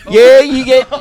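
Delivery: high, loud put-on British voice; squealed comic British accent; mock British accent. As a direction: ham up a randy Brit. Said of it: "do the accent back accidentally".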